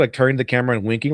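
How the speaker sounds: ordinary talk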